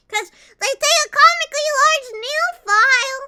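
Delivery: squeaky high complaint; high-pitched whine. Pretend.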